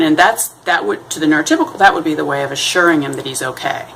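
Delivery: ordinary talk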